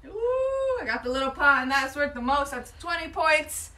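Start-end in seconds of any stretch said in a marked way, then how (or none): 0.0-0.8 delighted high ooh; thrilled at the lucky hit; excited ooh. Real, not acted. Lands on the best pot.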